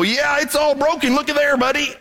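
high, offhand careless doctor. Retold or imitated. Doctor yanking a broken arm, high offhand careless voice. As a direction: toss it off while yanking the arm.

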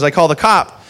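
ordinary talk

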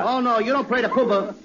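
ordinary talk, nothing marked

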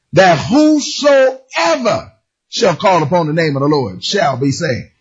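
slow, solemn "whosoever shall call"; scripture reading. Acted. Preacher reads the verse to the congregation. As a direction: read each word with weight.

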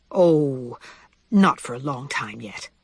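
low, offhand reply; forced casual. Acted. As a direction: brush it off as casually as possible. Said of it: "tried to sound as casual as she could".

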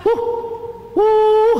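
high whoop, starting breathy; bunyip reply. Retold or imitated. Hunter imitates the bunyip reply drawing closer, high whoops. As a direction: answer as the beast coming nearer. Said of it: "And I heard".

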